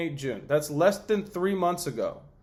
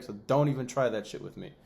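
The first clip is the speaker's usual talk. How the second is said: ordinary delivery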